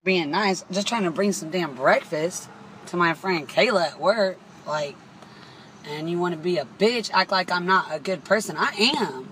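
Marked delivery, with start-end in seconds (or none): none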